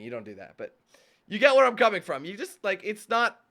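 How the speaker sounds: ordinary talk, nothing marked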